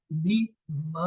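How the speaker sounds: بیما said slowly with the alif held; stretched madd. Shown as a demonstration. Teacher repeating the madd example slowly. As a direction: let بیما linger on the long vowel. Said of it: "دیکھیں".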